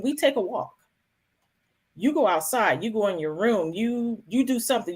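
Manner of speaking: ordinary delivery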